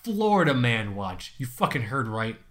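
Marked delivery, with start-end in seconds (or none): 0.0-1.3 slow, high game show announcement; announcer voice. Acted. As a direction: proclaim it like a host. Announcing the category title.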